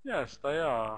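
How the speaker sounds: ordinary talk, nothing marked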